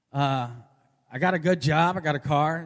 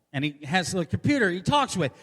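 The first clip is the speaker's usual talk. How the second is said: ordinary delivery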